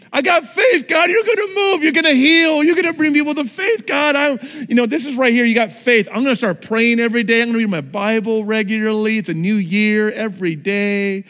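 high, loud, fast eager bragging to God; overexcited rookie believer promising God the world. Retold or imitated. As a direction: puff up like a brand-new believer sure of everything. Mocking eager new believer.

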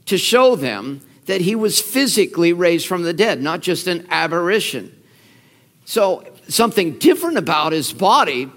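ordinary talk

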